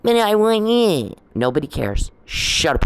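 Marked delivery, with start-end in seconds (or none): 0.0-1.1 high, slow mocking whine of a drama queen; mock whiny plea. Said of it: "Your little whiny little drama queen act".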